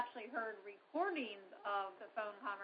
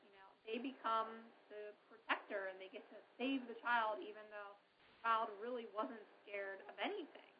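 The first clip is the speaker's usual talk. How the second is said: ordinary delivery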